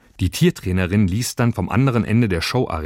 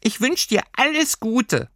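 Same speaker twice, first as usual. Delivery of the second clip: very high, slow creature farewell; fond little creature. Acted. Creature wishing Lilli well before leaving, high and slow. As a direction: wish her well like a tiny friend.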